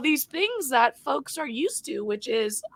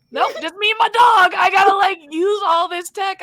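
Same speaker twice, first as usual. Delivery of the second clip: raised, mildly yelled self-talk; mock yelling; 5 semitones higher, 6 dB louder than usual. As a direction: act out yelling to yourself at home. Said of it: "it's just me yelling to myself".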